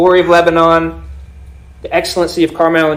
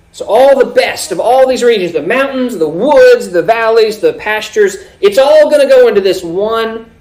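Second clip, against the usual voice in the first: higher, louder, punching each item in the list; forceful preaching; 6 semitones higher, 6 dB louder than usual. Acted. Preacher gathering all the best regions into one, forceful preaching.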